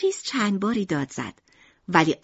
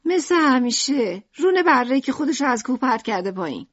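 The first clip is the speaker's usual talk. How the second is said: raised, louder, irritated answer; grumpy; 5 semitones higher, 5 dB louder than usual. A narrator's reading. Mother telling family what is for dinner, grumpy. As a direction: announce the usual dish with annoyance.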